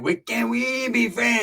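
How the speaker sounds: slow, slightly high asking voice; high Pepe. Retold or imitated. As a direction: plead it like a stoned Pepe. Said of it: "Pepe was in that motherfucker like".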